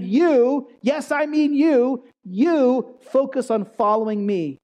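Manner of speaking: Jesus singling out Peter, stressing YOU; emphatic you. Shown as a demonstration. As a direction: lean hard on "You" and slow down. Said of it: "the you is emphatic".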